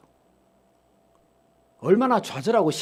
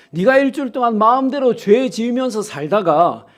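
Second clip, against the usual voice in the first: loud, higher, rising preacher's cry; preaching at full force; 6 semitones higher, 8 dB louder than usual. Acted. Preacher pressing the congregation about Cain, loud and high. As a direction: drive the point home to the pews.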